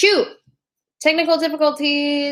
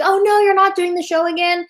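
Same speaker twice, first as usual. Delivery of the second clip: higher, lightly voiced fan in dismay; mock worried commenter; 8 semitones higher than usual. Host mimicking viewers' comments in a higher mock dismay. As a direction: play the fans' fake panic, lightly. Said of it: "people leaving comments like".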